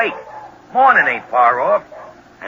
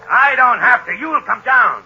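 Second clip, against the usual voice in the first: angry taunt; 10 semitones higher, 5 dB louder than usual. Acted. Gunman taunts the treed Shadow.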